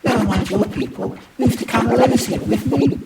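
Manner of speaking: high, very loud appeal; urgent plea. Acted. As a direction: urge them passionately to come away. Urgent plea to run away together.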